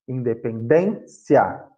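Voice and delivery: "independência" slowly, in-de-pen-DÊN... cia; teacher sounding out "independência", stressed DÊN; stress on penultimate syllable. Shown as a demonstration. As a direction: stretch "dên" in "independência", then detach the ending. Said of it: "é a penúltima sílaba da palavra".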